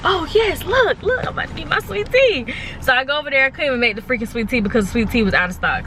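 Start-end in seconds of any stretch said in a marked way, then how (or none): 0.0-2.5 squealing high with delight; gleeful. Retold or imitated. Retelling getting the free cup, high and gleeful. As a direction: cheer over the free drink. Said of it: "And I was like".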